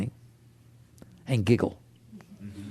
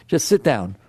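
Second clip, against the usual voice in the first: usual speaking voice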